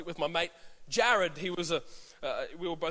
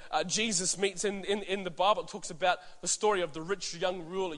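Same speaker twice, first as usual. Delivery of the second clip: ordinary delivery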